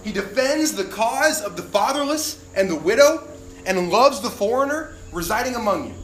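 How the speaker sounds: solemn scripture proclamation; scripture reading. Acted. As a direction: proclaim the verses with weight. Reads scripture aloud.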